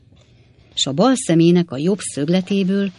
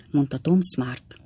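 ordinary delivery

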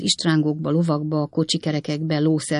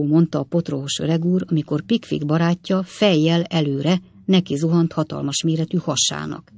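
ordinary delivery

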